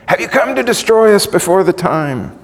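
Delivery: high-pitched, crying challenge; demon's cry. Retold or imitated. Preacher voices demons confronting Jesus, crying out. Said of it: "this was the cry of demon after demon".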